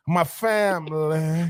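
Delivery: slow, drawn-out "My family"; a mock of the coach's solemn "my family"; mock coach quote. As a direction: draw it out like a somber coach. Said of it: "Brian Kelly?".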